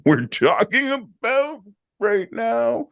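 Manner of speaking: high, slow, laughing through the words; can't hold back a laugh; giggling disbelief. Real, not acted. Laughs while retelling.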